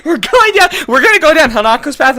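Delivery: very high, loud protest; insistent shout. Real, not acted. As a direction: shout your friend down. Arguing over which route to play.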